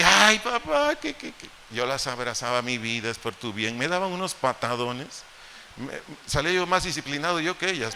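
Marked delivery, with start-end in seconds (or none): 0.0-1.5 much higher, loud then breathless; hammed-up child's protest; mock crying girl. Daughter being spanked, acted. 2.6-3.8 softer, lower comforting tone; a hint of loving dad; tender fatherly reassurance.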